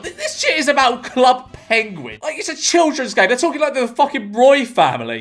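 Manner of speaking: high-pitched, exasperated disbelief; incredulous. Real, not acted. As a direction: protest at how absurd it is, pitch up. Incredulous rant at screenshots.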